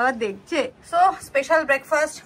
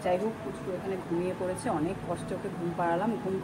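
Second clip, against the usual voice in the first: talking quietly; 9 semitones lower, 10 dB quieter than usual. Real, not acted. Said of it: "ওর জন্য আস্তে আস্তে কথা বলছি".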